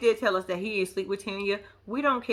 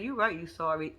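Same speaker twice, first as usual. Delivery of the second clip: mocking retort; 6 semitones lower, 6 dB quieter than usual. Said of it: "I was saying in the back of my head".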